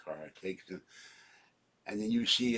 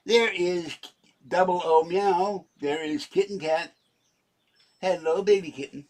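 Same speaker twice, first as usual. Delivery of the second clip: higher, louder cooing; 7 semitones higher, 6 dB louder than usual. Real, not acted. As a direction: coo at the kitten. Greeting his cats.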